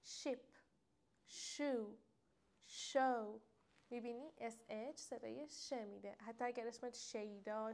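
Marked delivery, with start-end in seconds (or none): 0.0-3.4 شیپ، شو، شو, each alone with a "sh" onset; clear "sh" sound. Shown as a demonstration. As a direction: teach the "sh" in شیپ and شو word by word. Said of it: "صدای ش اصلی اس اچ".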